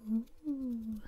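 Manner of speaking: plain reading voice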